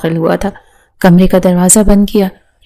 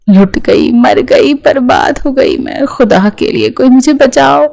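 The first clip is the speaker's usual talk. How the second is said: higher, hammed-up wail for help; mock wailing; 5 semitones higher than usual. A narrator's reading. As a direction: wail it out like a fake victim. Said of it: "وہ شور کر کے رونے لگی". (بناوٹی روتے ہوئے اونچی آواز میں دہائی)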